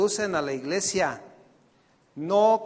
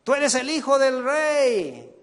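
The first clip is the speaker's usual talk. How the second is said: higher, slow, grand proclamation; preacher parodying a self-esteem sermon, higher and slow; mock motivator; 7 semitones higher, 4 dB louder than usual. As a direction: sell it like a prosperity preacher. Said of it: "ellos lo hacen con un enfoque de autoestima".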